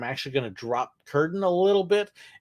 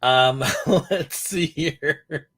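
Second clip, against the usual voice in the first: slow words through a laugh; much slower than usual. Real, not acted. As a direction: still chuckling as you speak. Laughing after bear puns.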